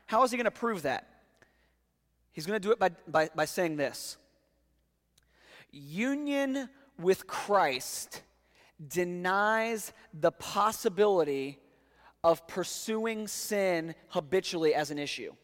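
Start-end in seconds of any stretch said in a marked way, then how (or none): 5.7-15.3 slow down so every word of the key sentence lands; slow emphatic statement. Shown as a demonstration. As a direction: lay out "Union with Christ" slowly, word by word. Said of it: "Let me repeat that".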